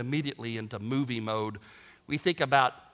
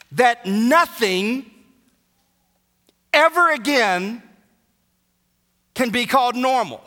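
raised, higher-pitched pulpit voice; full-force preaching; 7 semitones higher, 7 dB louder than usual. Acted. Preacher telling congregation death ends the sentence, full-force preaching. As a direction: drive each phrase home to the congregation.

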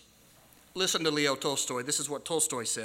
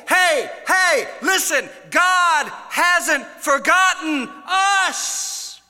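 much higher, louder, slow shout; 12 semitones higher, 10 dB louder, much slower than usual. Shown as a demonstration. Preacher demonstrating crying out the message, loud shout. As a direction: call out like a herald in the market. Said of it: "Cry it out. Don't whisper it".

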